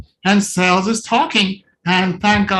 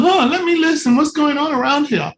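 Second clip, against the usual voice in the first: mock listening baby; 8 semitones higher than usual. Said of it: "It doesn't go".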